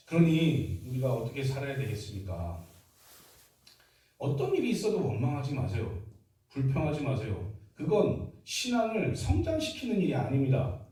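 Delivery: ordinary talk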